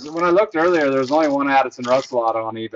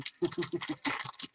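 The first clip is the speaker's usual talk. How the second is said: breathy laugh that hurts; laughing through the pain; 13 dB quieter than usual. Real, not acted. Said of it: "It hurts to laugh".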